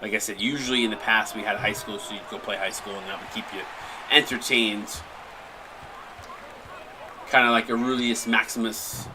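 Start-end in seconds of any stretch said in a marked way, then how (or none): none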